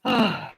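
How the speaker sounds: high, falling sigh for air; breathless sigh. Real, not acted. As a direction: let out a winded sigh. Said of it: "And I'm out of breath".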